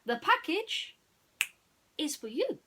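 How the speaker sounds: high, loud pleased explanation; Dawson voice. Acted. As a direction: reveal it proudly.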